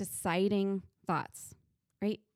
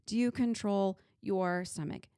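ordinary delivery

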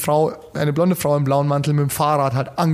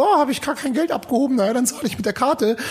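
much higher, airy mock posh mum shrugging it off; spoiled suburban mum; 8 semitones higher than usual. Mocking a careless rich mum who never reports lost money, much higher. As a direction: lampoon the careless rich mum going for champagne. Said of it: "verwöhnte, ähm, Glockenbacher Vorstadtmutti".

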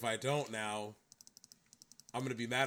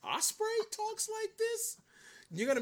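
shocked high squeak. Retold or imitated. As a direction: squeak out the disbelief. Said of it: "And I'm like".

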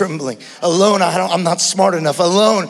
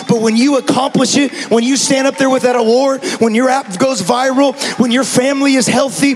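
put-on higher and louder preaching, building to a shout on "together, baby"; building sermon climax; 5 semitones higher, 3 dB louder than usual. Pastor rallying the church, rising to a full shout. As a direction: preach it up to the peak.

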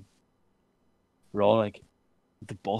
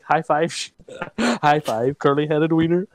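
playful, louder mock call; teasing call; 5 semitones higher, 5 dB louder than usual. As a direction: imitate pestering a friend for a high five. Acting out their teasing of friend.

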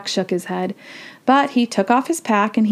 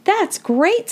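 put-on high-pitched, delighted little-girl voice; excited young girl; 11 semitones higher than usual. A narrator's reading. Annie reacts to the woman's news, excited.

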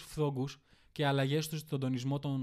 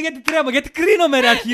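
high, loud mock yell of the quote; mock shout; 10 semitones higher, 14 dB louder than usual. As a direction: yell it as a hammed-up retort. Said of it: "Και μετά μου λέει".

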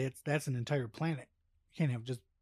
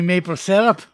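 mock Canadian; 5 semitones higher, 13 dB louder than usual. Joking Canadian riffs.

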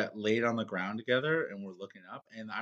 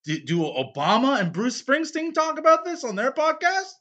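high, loud comic protest; mock indignation; 13 semitones higher, 6 dB louder than usual. Host comparing his podcast to famous ones, mock indignant. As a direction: push the questions up high in mock outrage.

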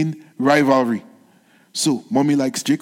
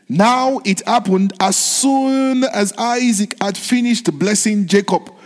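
solemn scripture reading; 4 semitones higher than usual. Acted.

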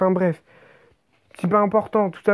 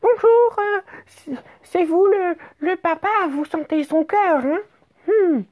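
much higher, sweet falsetto nurse; high falsetto imitation of the nurse; nurse's voice; 12 semitones higher than usual. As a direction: squeak it out like an overly sweet nurse. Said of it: "je fais sa voix".

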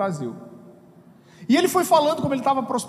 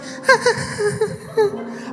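a high, delicate little sob, played for laughs; mock a lady crying prettily into a hanky, much higher; dainty woman's cry; 11 semitones higher than usual. Shown as a demonstration. Pastor mocking how women cry for the congregation, high dainty sob. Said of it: "Então a mulher chora assim".

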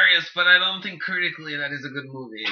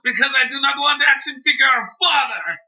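angry Russian rant; 6 semitones higher, 6 dB louder than usual. Acted. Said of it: "Oh my god".

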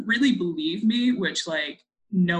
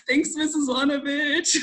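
slow, higher sarcastic thanks with a laugh; playfully long-suffering, pitched up; mock thanks; 6 semitones higher than usual. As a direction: draw out a laughing, grudging thank-you. Mock thanks to teasing teacher.

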